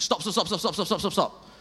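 high, loud repeated stop; a sudden urgent command in the head; the Spirit's voice shouting stop mid-prayer; urgent inner voice. Retold or imitated. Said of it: "that urgent in my head and loud".

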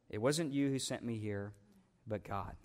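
low, soft restatement of the verse; hushed repeat. Real, not acted. Preacher repeats the verse to let the congregation absorb it, hushed.

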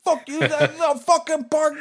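high, loud furious yell; angry yell. Retold or imitated. Runner yells at speeding driver in park, high furious shout. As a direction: scream it at the driver who cut you off. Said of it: "I started yelling at him".

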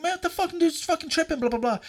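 retold exasperated grumble; worked-up complaint. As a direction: vent quickly to a buddy.

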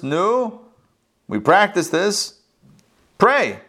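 a mild, growing nervous urge; getting nervous. A narrator's reading. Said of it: "starting to get a little bit nervous".

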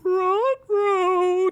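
very high cartoon dog yelp; Ruh-roh cartoon voice. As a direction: squeak it like a scared cartoon dog. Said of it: "Toadstool said".

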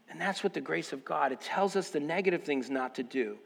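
low, very soft reflection; subdued personal testimony. Real, not acted. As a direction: confide it quietly, from the heart. Preacher reflects tenderly on absent correction.

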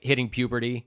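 usual speaking voice

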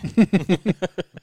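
loud, high burst of laughter. Real, not acted. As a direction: let out a big laugh. Loud laugh after retold story.

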